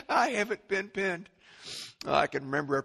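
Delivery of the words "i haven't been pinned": high, laughing repeat. Real, not acted. Preacher repeats the line, laughing at himself. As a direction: laugh it out with relief.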